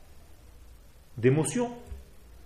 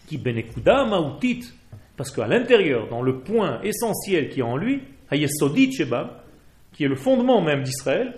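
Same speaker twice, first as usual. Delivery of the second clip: louder, higher, slow-stressed sermon chant; fervent preaching; 6 semitones higher, 8 dB louder than usual. Real, not acted. Rabbi expounding Hebrew verses to his class, preaching fervently. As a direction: preach it with full conviction.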